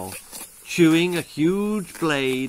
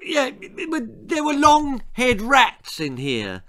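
higher, stammered excuse; raised-pitch cover story; girl lying; 10 semitones higher, 3 dB louder than usual. A narrator's reading. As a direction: blurt out a made-up excuse. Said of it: "lied Esmeralda".

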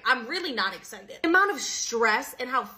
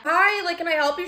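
high, loud exasperated greeting; acted-out annoyed "can I help you"; irritated phone answer; 6 semitones higher, 4 dB louder than usual. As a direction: answer the nagging caller with sharp impatience. Answering mom's annoying callback.

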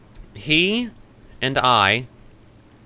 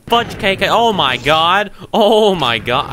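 loud, high, drawn-out cry of panic; panicked; 8 semitones higher, 7 dB louder, slower than usual. Real, not acted. Player thinks they're gonna die, loud panic. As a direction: stretch it out, high and loud, in alarm.

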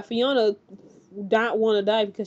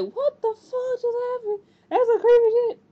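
much higher, stunned voice; shocked; 13 semitones higher than usual. Retold or imitated. Vlogger reacting to a shootout, high shocked voice. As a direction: react in disbelief. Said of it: "I'm like".

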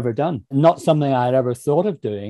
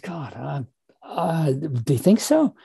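much softer and slow, an awed half-whisper; hushed disbelief; 3 semitones higher, 5 dB quieter, slower than usual. Retold or imitated. Publisher offers him the audiobook, he answers in hushed disbelief. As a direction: say it like you can't believe they asked.

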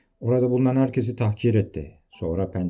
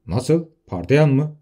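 jumps in higher and louder, caught off guard; startled; 6 semitones higher, 6 dB louder, slower than usual. Acted. Desparamont startled to hear the name. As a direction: blurt it out in shock.

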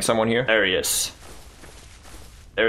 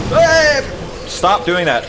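sharp high shout; protesting yell; 10 semitones higher, 3 dB louder, slower than usual. Real, not acted. Gamer telling the boss to stop, protesting yell.

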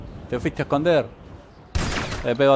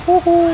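high, loud whoop of glee; 8 dB louder than usual. Real, not acted. Gamer cheering a hit on an enemy, high whoop. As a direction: whoop like you just won. Celebrating in game.

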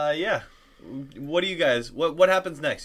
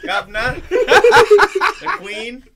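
laughing, loud mock British; hammed-up Brit voice, higher and louder; British accent; 10 semitones higher, 12 dB louder, much slower than usual. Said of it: "a British accent".

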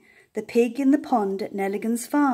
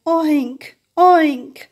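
louder, higher oinking; pig oink; 4 semitones higher, 7 dB louder than usual. A narrator's reading. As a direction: grunt it out like a hot pig. Pig's grunts in story.

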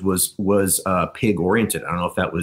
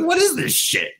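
much higher, baffled mock voice; imitating friend. Friend imitating Scott getting manicotti, high baffled voice. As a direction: squeak it out in disbelief. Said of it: "He's like".

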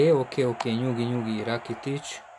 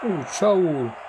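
excited hungarian sports commentary. Real, not acted. Live excited match commentary.

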